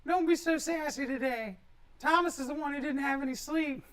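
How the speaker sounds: squeaky mocking tease; high mock voice. Teasing co-host in mock voice.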